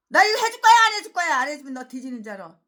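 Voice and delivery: starts high and very loud, then settles to a mutter; a bully shaking someone down; mock threat. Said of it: "이런 식으로 협박".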